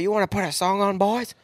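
high, keen offer with rising end; eager imitation. Driver offering the boys some music, eager. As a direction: play the driver pitching it up to the boys.

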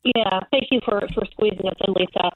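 muffled bad phone line; garbled underwater connection. Real, not acted. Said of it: "You sound like you're underwater".

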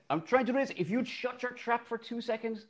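a sub teacher losing patience; exasperated teacher. Retold or imitated. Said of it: "And I said".

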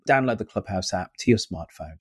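ordinary talk

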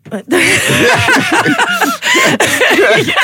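high-pitched burst of laughter; hearty laugh. Real, not acted. Singer cracking up at mention of her old teacher, hearty laugh.

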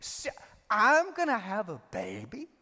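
put-on slow, incredulous old Sarah; a hint of a laughing Sarah in disbelief; Sarah disbelieving. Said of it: "She's like".

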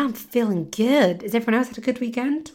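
ordinary talk, nothing marked

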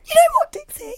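high, loud mock-tearful voice; hammed-up weepy wail; pretend crying. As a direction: fake tears to guilt-trip a child. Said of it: "I pretended to cry".

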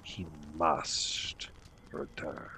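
ominous fortune teller. Acted. Seer names the Dark Lord.

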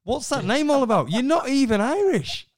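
high, loud incredulous scoff, building; a disbelieving parent scoffing down the phone; mocking parent. Parent mocking fake Irish name.

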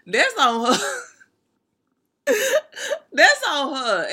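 shouted through laughter; loud laughing emphasis. Real, not acted. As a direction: belt it out while laughing.

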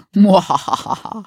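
hammed-up villain cackle; evil laugh. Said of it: "That's my evil laugh".

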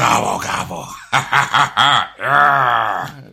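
loud mock wrestling-boss voice with a big laugh; Vince McMahon. Friend derailing the serious note, loud promoter impression. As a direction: crow it out as a hammed-up promoter. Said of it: "I did a Vince McMahon voice".

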